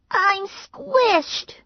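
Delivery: put-on very high whiny little girl. A narrator's reading. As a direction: whine like a small girl squeezed at the table. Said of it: "Mary Alice said".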